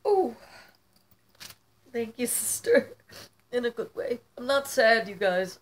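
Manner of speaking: high-pitched, tearful gratitude. Real, not acted. Thanking a sister for her gift, tearful in a good way. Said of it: "getting it out".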